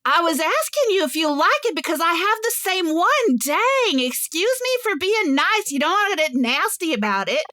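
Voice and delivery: a hammed-up huffy stranger; offended retort. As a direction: take offence and snap back. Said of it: "the woman on the bench goes".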